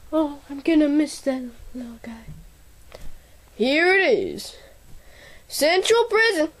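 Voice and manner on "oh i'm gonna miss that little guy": hushed aside; whispering. Acted. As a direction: whisper it sadly.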